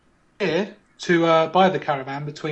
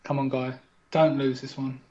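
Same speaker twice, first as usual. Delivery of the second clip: low hushed live commentary; game commentator; 4 semitones lower, 4 dB quieter than usual. Acted. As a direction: talk him up like a TV commentator. Mock game-show commentary.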